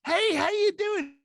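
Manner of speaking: high, slow, overly chirpy greeting; fake friendly hello. Stranger gushing a greeting at you. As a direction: sing out the hello like a stranger who wants something. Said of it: "they walk over to you and they go".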